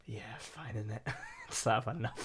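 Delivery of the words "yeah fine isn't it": mock bored listener. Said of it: "And I was like".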